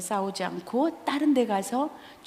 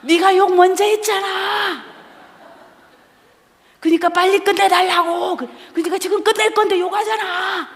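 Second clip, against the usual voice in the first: put-on loud, much higher, bickering in a childish voice; loud comic squabble; 10 semitones higher, 8 dB louder than usual. Acting a comic squabble between professor and student, loud childish bickering. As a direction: shout it like a kid arguing back. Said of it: "코미디가 되기 시작해".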